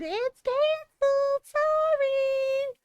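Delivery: high, slow sing-song mock apology; mocking robotic voice. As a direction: sneer the email's fake apology. Mocking automated email.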